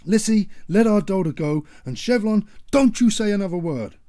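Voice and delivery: loud, high commanding orders; father ordering wife and daughter to stop; stern shouting. Acted. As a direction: lay down the law.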